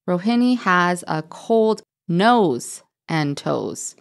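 slow, careful rereading of the sentence. Shown as a demonstration. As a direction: reread it slowly to check. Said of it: "Oh, yes".

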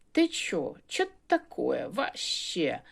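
mock Russian accent, slow; imitating a foreigner's Russian, drawn out; a hint of a Russian outsider; Russian speech. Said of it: "То чужаки".

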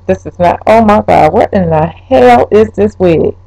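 ordinary talk

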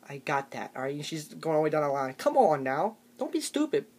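ordinary delivery

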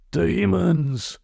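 high, drawn-out cry of dread; hammed-up terror; alarmed cry. A narrator's reading. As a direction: cry out in mock horror, high and long. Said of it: "cried one".

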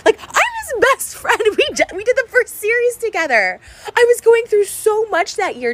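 very high, tearful protest; hurt, upset outburst. Real, not acted. Vlogger protesting her best friend's mockery, high hurt outburst. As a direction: plead it loud and high, close to crying.

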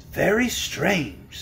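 slow, drawn-out pondering; sober. A narrator's reading. Wizard puzzling over invisible pets, sober. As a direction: weigh it soberly, taking your time.

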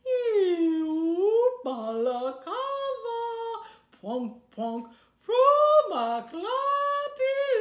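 very slow, stretched, loud whale call; speaking whale. Acted. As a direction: moan it out like a whale singing. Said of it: "how to speak whale".

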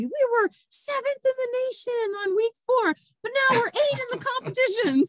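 high, quick, proud announcement; excited boast. Retold or imitated. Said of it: "I just keep telling everybody".

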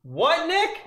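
loud, high call to Nick. Real, not acted. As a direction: yell across the house. Calling out to Nick.

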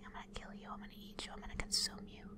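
menacing unvoiced whisper; creepy whisper. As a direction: hiss the threats softly at prey. Whispered threats to prey creature.

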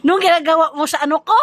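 high, fast, loud laughing shout; high excited shout. Real, not acted.

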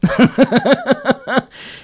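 high-pitched burst of laughter; real, tickled cackle. Real, not acted. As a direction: laugh out in a high, rapid run. Laughs at the kless joke.

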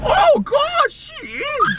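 high squeak of oh gosh; mock shock. Real, not acted. Friend squeals at the cold Melbourne weather.

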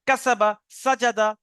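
slow drill, word by word; slow reading drill. Shown as a demonstration. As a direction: read the words slowly one by one. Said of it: "বাকি শুধু reading করবেন". (ধীরে ধীরে শব্দ পড়া)

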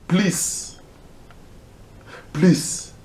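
high, strained please; a last desperate beg; real cry of please, pitched high; anguished plea. Real, not acted. Begging police not to shoot.

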